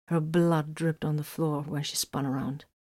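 ordinary delivery, nothing marked

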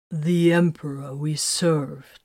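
slow, solemn intoning of an oath; intoned oath. A narrator's reading. Titus leads the oath to the Emperor, intoning. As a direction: intone it slowly, word by measured word. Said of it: "Titus intoned".

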